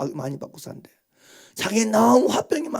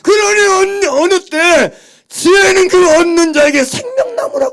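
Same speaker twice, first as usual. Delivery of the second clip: high, loud sermon cry; fervent loud preaching; full-force preaching; 11 semitones higher, 11 dB louder than usual. Real, not acted.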